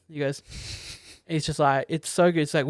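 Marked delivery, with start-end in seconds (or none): none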